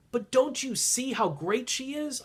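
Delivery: pushy pleading. Retold or imitated. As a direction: urge them to see it, eager and insistent. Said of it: "I get all in people's faces".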